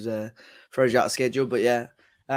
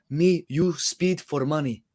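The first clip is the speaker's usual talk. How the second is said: mock Russian accent; 4 semitones higher, slower than usual. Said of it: "didn't know there were Russians here".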